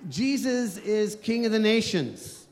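ordinary talk, nothing marked

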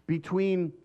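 ordinary talk

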